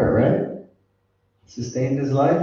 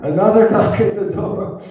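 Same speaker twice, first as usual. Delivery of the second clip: loud exclaim; 7 semitones higher, 7 dB louder than usual. Acted. Exclaiming about another knock.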